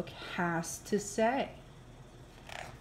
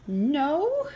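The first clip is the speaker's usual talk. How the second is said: disbelieving No; 6 semitones higher, 4 dB louder than usual. Acted. Reader pleads disbelieving.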